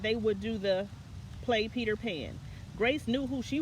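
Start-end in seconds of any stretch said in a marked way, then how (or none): none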